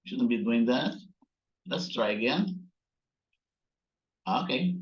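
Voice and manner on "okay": usual speaking voice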